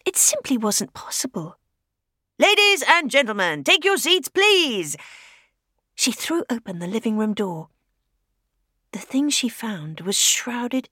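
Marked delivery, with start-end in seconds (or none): 2.4-4.9 announcer voice. Acted. Scarecrow calling out like a radio announcer. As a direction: announce it like a music-hall compère.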